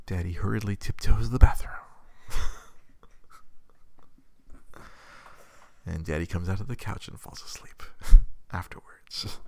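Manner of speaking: hushed, breathy storybook whisper; whispered narration. Real, not acted. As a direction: tiptoe narration, whispered with giggles. Narrating sneaking while baby sleeps.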